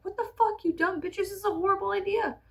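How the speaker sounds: high-pitched mock outrage from the townsfolk; mock outraged crowd. Townspeople reacting to the monkey lab, mock outraged. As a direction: squeak it out like scandalized neighbors.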